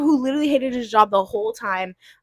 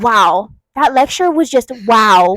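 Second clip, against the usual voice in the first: mock impressed; 7 dB louder, slower than usual. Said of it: "She goes".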